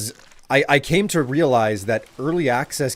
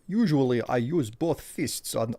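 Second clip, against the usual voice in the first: put-on Russian accent; 7 semitones higher than usual. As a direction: greet the monster as a grinning Russian bruiser in Russian accent. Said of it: "for a Russian accent".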